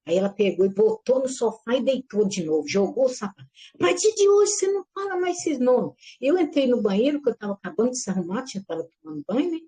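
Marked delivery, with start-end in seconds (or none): 3.7-5.9 high, tense retold scolding; a shifted-voice replay of the mother's ban; mother's angry order again. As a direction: forbid the names like a cross mother.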